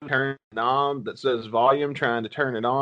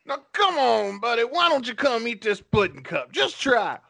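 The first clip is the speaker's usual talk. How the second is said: much higher sly coaxing voice; Bill Cosby impression; 9 semitones higher than usual. Host as creepy comic coaxing a victim, high and sly. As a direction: lure them in sweetly. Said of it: "my Bill Cosby impression".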